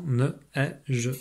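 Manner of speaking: "ne, e, je" as three separate sounds, "e" an open è; "ne... e... je", one sound at a time; sounding out phonemes. Shown as a demonstration. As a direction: break it into "ne", "e", "je", pausing between. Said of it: "il y a trois sons".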